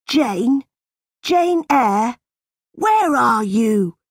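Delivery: high-pitched, drawn-out call. A narrator's reading. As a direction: call through the house for someone. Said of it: "John shouted".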